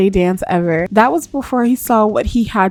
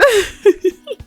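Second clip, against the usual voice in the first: rising high-pitched giggle; 11 semitones higher than usual. Real, not acted. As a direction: giggle helplessly. Laughing at the storyline.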